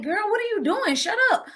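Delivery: high, scolding disbelief at a cousin; mock exasperated scold. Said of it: "I was like".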